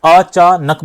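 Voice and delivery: "अचानक" with stops, अ, चा, नक; halting syllables. Shown as a demonstration. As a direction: pause between the syllables of "अचानक", नक whole. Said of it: "यदि रुक रुक कर". ("अचानक" रुक-रुक कर अ-चा-नक बोलें)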